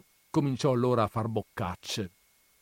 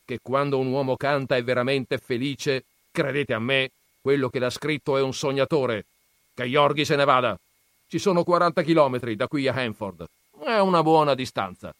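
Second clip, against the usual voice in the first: lightly voiced scornful old grandfather, raised; gruff grandfather; 5 semitones higher, 7 dB louder, faster than usual. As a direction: scoff at the books' dreamers. Grandfather scoffs. (nonno burbero accennato, tono sprezzante)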